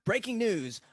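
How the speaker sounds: high, loud breaking-news announcer; best announcer voice. Shown as a demonstration. Guest reads his mock post on request, announcer voice. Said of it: "in your best announcer voice".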